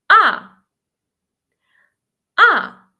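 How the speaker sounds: AH starting high and dropping fast; short loud AH, like an angry no; fourth tone, falling sharply. Shown as a demonstration. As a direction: hit AH high, then plunge. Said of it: "Fallen sharply".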